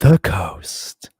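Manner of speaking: low put-on station ident; deep smooth-jazz DJ tag; radio voice. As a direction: drop it low like a radio announcer. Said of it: "With your radio voice?".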